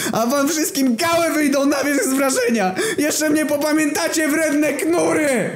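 high, strained cartoon voice swearing revenge; defeated footballer vowing revenge on everyone, high and strained; vengeful vow. Vengeful vow after lost match.

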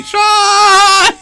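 high, loud call of the name; yelling. Retold or imitated. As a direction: shout the name after him. Echoing the game's yelling.

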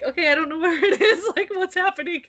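retelling her flustered panic while hunting for the button. Retold or imitated. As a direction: act out being lost and rattled. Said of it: "And I was all flustered".

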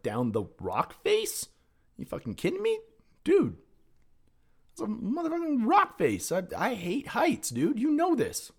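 high-pitched, incredulous protest; alarmed disbelief. Retold or imitated. Climber protesting to his friend about the rock face, alarmed disbelief. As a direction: push the pitch way up in aghast disbelief.

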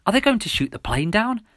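high, loud alarmed question; panicked. A narrator's reading. Runner fearing plane will be shot, panicked question. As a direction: blurt it out in panic, pitched high. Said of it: "I asked in a panic".

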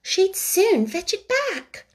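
put-on high, slow girlish Alice voice; a child talking to herself. Alice answering aloud, in a high childlike voice.

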